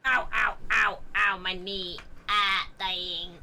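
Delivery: tripping and falling. Pretend. Hurt knee in game.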